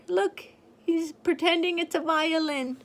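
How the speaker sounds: ordinary delivery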